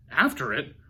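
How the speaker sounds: usual speaking voice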